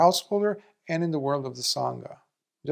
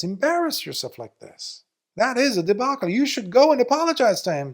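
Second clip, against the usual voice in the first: higher, firm scolding order; 8 semitones higher than usual. Retold or imitated. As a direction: tell him to go apologize. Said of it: "Lord Buddha scolds him".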